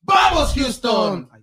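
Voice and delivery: high, slow shouted sign-off; shouted slogan. Acted. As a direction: belt out the slogan.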